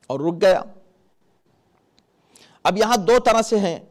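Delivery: ordinary delivery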